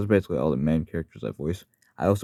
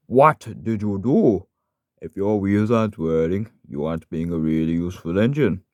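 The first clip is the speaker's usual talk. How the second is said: slow, raised, put-on engine voice; Skarloey; 3 semitones higher, 5 dB louder, slower than usual. Shown as a demonstration. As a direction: scold like an indignant little engine. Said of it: "Now for Skarloey".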